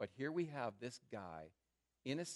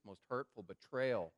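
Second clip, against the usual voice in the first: ordinary talk, nothing marked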